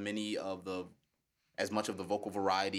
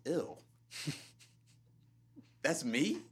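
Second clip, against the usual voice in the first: retold disgust; 9 semitones higher than usual. First hearing own recorded voice.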